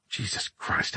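breathy whispered curse; whispered oath. Acted. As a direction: curse it under your breath. Muttered oath under breath.

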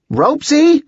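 urgent high-pitched call; demanding. A narrator's reading. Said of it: "Holly demanded".

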